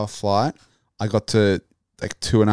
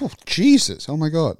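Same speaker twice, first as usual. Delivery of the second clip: starstruck surprise; 8 semitones higher, 5 dB louder than usual. Retold or imitated. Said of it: "I was like".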